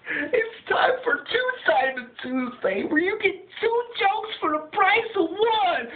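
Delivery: high, slow, whiny host call; high show intro voice. Acted. Host whining out the show intro, high. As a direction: drawl the opener in a high crying voice. Announcing Two Simon Tuesday.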